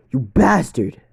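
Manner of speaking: loud, high angry yell; furious shout. Acted. Guard screams at Izuku over Benji, furious yell. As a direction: bellow it in rage.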